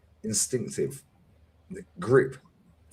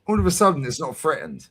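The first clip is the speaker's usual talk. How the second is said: ordinary talk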